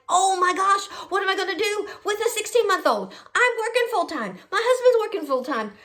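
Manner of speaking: high-pitched, worked-up fretting; mock panicked wail; retold panic. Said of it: "I wake up with this panic attack".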